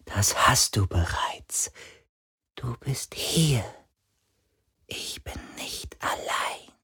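slow, soft ghostly whisper; tender whisper. A narrator's reading. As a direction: comfort a friend in a whisper.

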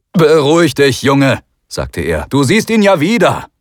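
a hint of a gruff soldier calming a boy; lightly voiced sergeant. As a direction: soldier trying to sound reassuring.

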